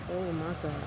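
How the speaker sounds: ordinary talk, nothing marked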